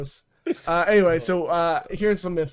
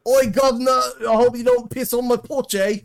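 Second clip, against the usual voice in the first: hammed-up British accent, higher and louder; mock British accent; 8 semitones higher, 8 dB louder than usual. Mocking his friend's claim with a louder, higher, hammed-up British accent. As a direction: go full cockney British, cheeky and over the top. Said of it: "You would've gone full British".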